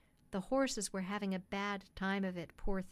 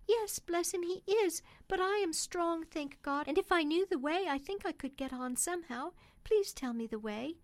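higher-pitched woman's voice, urgent; determined pleading mother; 7 semitones higher than usual. Acted. Mother begging cabman for directions, high-pitched and earnest. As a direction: plead as a poor country mother.

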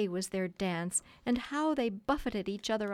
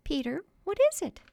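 high, louder questioning voice; concerned wife; 10 semitones higher, 5 dB louder, faster than usual. A narrator's reading. Wife to husband who looks uncomfortable, concerned question. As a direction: press him with a high-pitched question.

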